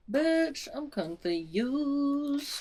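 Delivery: high, drawn-out baffled whine; exaggerated confusion. Real, not acted. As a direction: stretch it out high in bewilderment. Reacting to a plot twist.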